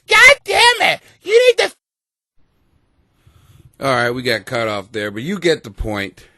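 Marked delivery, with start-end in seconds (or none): 0.1-1.7 high shouted woman caricature; mocking woman. Mimicking a woman fed up with a man, high mock shout. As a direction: yell it like a frustrated girlfriend. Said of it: "you go".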